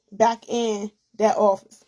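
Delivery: ordinary talk, nothing marked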